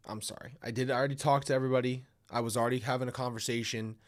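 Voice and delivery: ordinary talk, nothing marked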